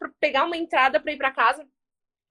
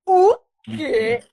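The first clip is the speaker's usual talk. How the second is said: higher-pitched reenacted shock; incredulous outburst, retold; stunned disbelief; 4 semitones higher, 4 dB louder than usual. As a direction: act out being floored by the news. Retold stunned reaction to news.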